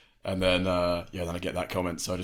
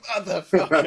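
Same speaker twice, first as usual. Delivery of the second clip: laughing; 11 semitones higher, 7 dB louder than usual. Retold or imitated. Retelling own reaction, laughing.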